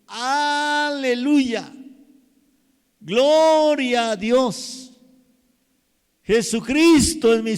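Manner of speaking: long, high, loud drawn-out "¡Aleluya!"; jubilant shout. Shown as a demonstration. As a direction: shout "¡Aleluya!" slowly with all your joy. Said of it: "Qué diferente".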